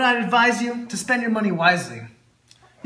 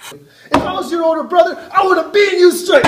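loud, higher threatening shout; angry shout; 8 semitones higher, 8 dB louder than usual. Acted. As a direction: threaten like a big brother. Threatening the shopaholic.

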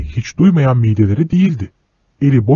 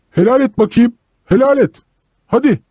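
much higher, pressing yell; insistent shout; 11 semitones higher than usual. Acted. Man shouting from the speeding train over the wheels, insistent yell. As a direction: push it over the noise of wheels.

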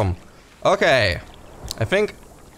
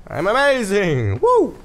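high boast into a whoop; gleeful triumph; 7 semitones higher than usual. Real, not acted. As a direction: cheer yourself on after winning. Celebrates winning the fight.

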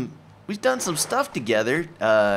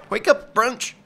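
high sleepy character voice; 10 semitones higher, 3 dB louder than usual. Pretend. Character voice answers.

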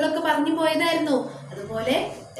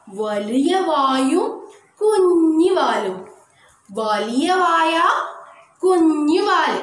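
slow, drawn-out description; 3 dB louder, much slower than usual. Shown as a demonstration. Teacher describing letter shape.

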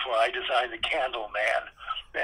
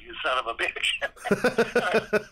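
each word stretched out, a little higher; slow drawn-out curse; 6 semitones higher, much slower than usual. Retold or imitated. Walt at Rolly's desk at dawn, slow drawn-out curse. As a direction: let the curse crawl out, grudging.

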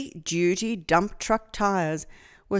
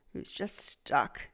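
whisper; 5 semitones lower, 8 dB quieter than usual. Real, not acted. Reading aside to child.

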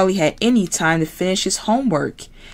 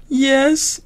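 higher frog voice; Kermit voice; 9 semitones higher than usual. Acted. Kermit answering Piggy on the phone, frog voice.

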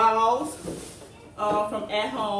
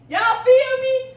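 excited squeal; 12 semitones higher, 8 dB louder, slower than usual. Real, not acted. Excited about pink chair.

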